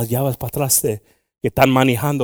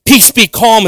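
commanding the storm; 9 semitones higher, 14 dB louder, slower than usual. Retold or imitated. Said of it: "stand up in the middle of a boat and say".

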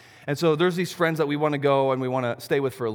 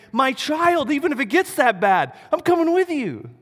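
higher, tender fatherly promise; voicing God the Father; 9 semitones higher, 3 dB louder than usual. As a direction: reach up gently as a loving father reassuring his child. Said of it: "is saying".

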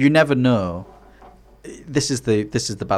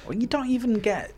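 mock Hartlepool voice; 13 semitones higher, 4 dB quieter than usual.

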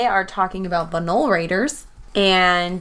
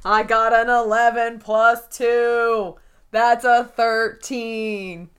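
slow, drawn-out triumph; gloating; much slower than usual. Real, not acted. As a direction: savour each word of the winning roll. Gloats over a winning roll.

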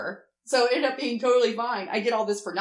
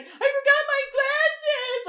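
high, slow wailing cry; mock bawling; 4 dB louder, much slower than usual. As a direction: wail it out like a meltdown tantrum. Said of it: "Like that, and I'm bawling".

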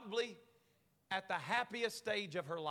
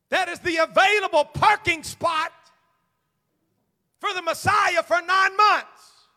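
full-force preaching; 7 semitones higher, 14 dB louder than usual. Real, not acted. Preacher driving his point home to congregation, shouting.

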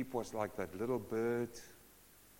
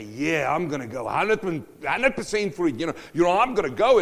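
loud, brash and reckless; a cocky voice charging ahead; defiant sinner; 3 semitones higher, 10 dB louder than usual. Acted. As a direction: shout it like you don't care what's right.

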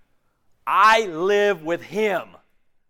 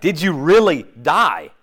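loud; 4 semitones lower, 10 dB louder than usual. Real, not acted. Preacher presses congregation hard.

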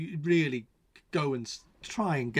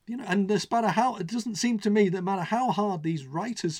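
raised, high-pitched, emphatic exasperation; real despairing tirade, louder and higher; exasperated rant; 9 semitones higher, 7 dB louder than usual. Real, not acted. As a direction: build to a loud, incredulous outburst, dragging out the last words. Climax of exasperated political rant.

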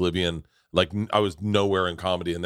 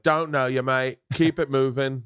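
mock London bouncer slipping into Australian, pitched higher; Australian-sounding accent; 4 semitones higher, slower than usual. Doorman turns comic away at London comedy club, Australian accent. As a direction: turn them away in a higher, off-target Australian twang. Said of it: "That's Australian".

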